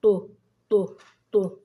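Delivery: طو as a loud, separate "tu"; letter with damma. Shown as a demonstration. Teacher has pupils listen to the damma sound, loud separate طو. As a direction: punch out each طو with a rounded u. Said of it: "نضع حركة الضمة وننطقه".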